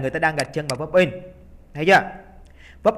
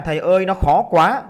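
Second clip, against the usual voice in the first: mimicked complaining student; 3 semitones higher, 6 dB louder than usual. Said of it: "nhiều bạn cứ nói rằng".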